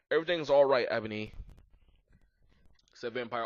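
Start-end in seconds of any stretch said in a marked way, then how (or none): none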